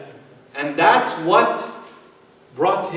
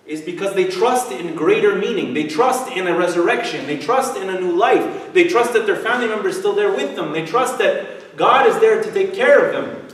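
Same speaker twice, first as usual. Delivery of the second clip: rising sermon emphasis; 3 semitones higher, 4 dB louder than usual. Acted. Preacher builds on trust.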